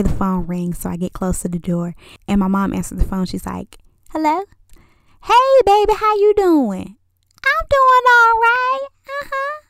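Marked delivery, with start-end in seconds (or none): 4.1-7.0 high, sweet phone greeting; sing-song hello like a mom answering the phone; mom's phone voice. Retold or imitated. 7.4-9.6 mom's phone voice. Retold or imitated. Mom continues phone chat.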